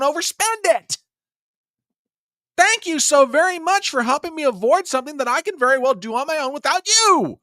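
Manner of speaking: high, fast, mocking outrage; sarcastic rant. Real, not acted. Host sarcastically ranting about the president's bank-fee boast. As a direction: drip sarcasm while venting.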